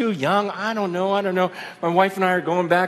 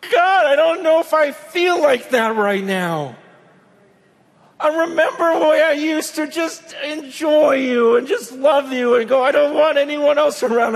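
higher, louder, near-shouted plea; raised, aching prayer; pleading prayer; 6 semitones higher, 3 dB louder than usual. Retold or imitated. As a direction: cry out to God with longing. Retold anguished prayer to God.

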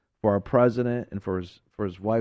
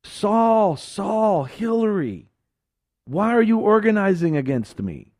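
higher, louder voice of the Lord calling out by name; 7 semitones higher, 3 dB louder, slower than usual. Acted. The Lord calls out to those organizing against him. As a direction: call them by name from heaven.

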